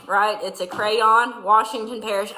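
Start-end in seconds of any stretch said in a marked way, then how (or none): none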